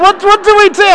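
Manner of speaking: high, panicky question, hammed-up; mock panic. Driver stuck without petrol, mock panic. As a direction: play the fear big and high. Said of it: "I was like".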